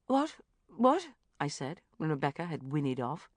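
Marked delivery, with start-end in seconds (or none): 0.1-1.1 baffled. A narrator's reading. Bridget baffled.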